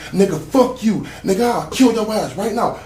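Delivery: high, heated threat; retold threat. Gunman threatening to kill the speaker, retold angry. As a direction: spit the threat out, mock angry.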